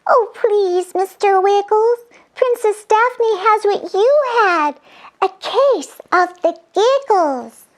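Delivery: very high fairy voice; fairy with hurting ears; tiny fairy. Acted. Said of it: "said Fairy Cora".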